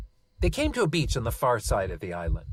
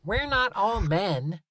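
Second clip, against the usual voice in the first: put-on high, slow, indignant little girl; scowling; 9 semitones higher, slower than usual. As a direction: scowl it out like a cross kid. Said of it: "Talia said with a scowl".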